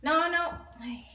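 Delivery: ordinary delivery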